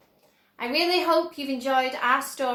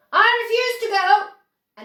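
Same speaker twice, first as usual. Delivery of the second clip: put-on higher and louder, an old man's declaration; firm loud announcement; 8 semitones higher, 9 dB louder than usual. A narrator's reading. Grandpa George refusing Wonka, firm loud declaration. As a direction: plant your feet and declare it. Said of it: "announced Grandpa George".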